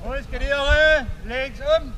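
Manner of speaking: loud parade-ground orders; barked drill commands. Real, not acted. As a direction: bark the orders at the squad.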